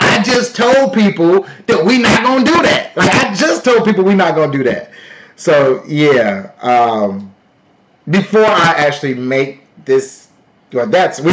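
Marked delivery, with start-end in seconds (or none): 0.0-4.9 raised pitch, mock protesting viewers; imitated indignant protest. Streamer imitating protesting viewers, mock indignant. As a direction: play the exasperated objector, pitched up. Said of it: "And they be like".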